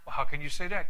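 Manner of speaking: normal talking voice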